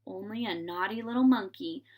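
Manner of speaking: ordinary delivery, nothing marked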